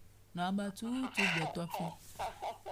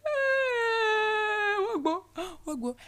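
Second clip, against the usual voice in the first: high, loud mock wail of grief; wailing cry; 13 semitones higher, 9 dB louder, much slower than usual. Preacher voices the weeping camp, high loud wailing. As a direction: wail like a grieving crowd. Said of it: "all the people in the camp were crying".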